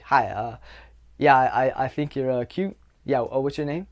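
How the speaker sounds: halting, low mumbled come-on with uhs; tense, awkward approach. Shown as a demonstration. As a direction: act nervous and unsure asking someone out. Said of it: "everything is wrong there".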